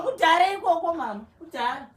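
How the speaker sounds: loud, bitter outburst; angry shout. Acted. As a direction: shout it down at her. Daughter shouts at mother.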